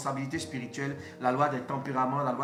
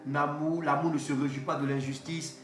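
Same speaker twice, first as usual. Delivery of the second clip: solemn sermon reading; 2 semitones lower, 3 dB quieter than usual. Acted. (lecture solennelle et lente, pleine de recueillement)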